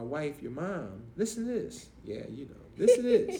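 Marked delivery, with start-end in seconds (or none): 2.8-3.4 high, loud excited repeat; excited call for attention. Real, not acted. Telling his children, high and loud. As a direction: grab their attention with a shout. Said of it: "emphatic repeat".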